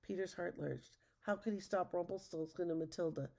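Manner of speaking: plain reading voice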